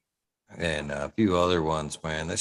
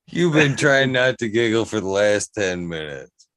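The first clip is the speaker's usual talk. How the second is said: laughing; 4 semitones higher, 7 dB louder than usual. Real, not acted. Teasing friend about giggling.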